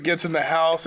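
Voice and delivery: normal talking voice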